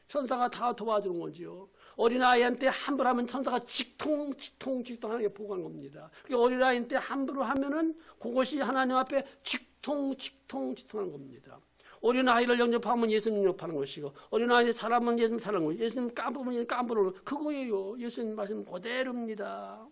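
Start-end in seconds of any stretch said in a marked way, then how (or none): none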